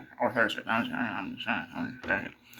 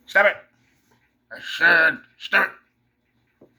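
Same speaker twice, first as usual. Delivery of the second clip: firm command; 5 semitones higher, 6 dB louder, much slower than usual. Real, not acted. Commanding the dog.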